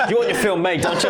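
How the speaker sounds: high, laughing taunt; laughing tease. Real, not acted. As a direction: tease your co-host through laughter. Teasing co-host amid laughter.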